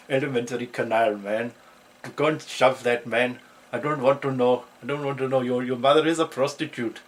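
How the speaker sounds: loud mock Indian schoolkid accent. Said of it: "class were Indian".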